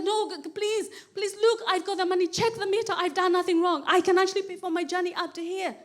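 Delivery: acted-out anxious begging; retold pleading. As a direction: plead your innocence urgently. Retold pleading with police officer.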